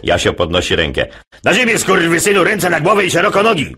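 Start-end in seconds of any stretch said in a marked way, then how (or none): 1.4-3.8 police bark. Acted. Jasiu answering what dogs say, mock police command. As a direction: bark it like a raiding cop.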